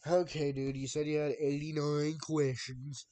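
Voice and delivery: loud exasperated outburst. Real, not acted. Streamer to chatter who promised questions, loud and exasperated. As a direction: raise your voice, fed up.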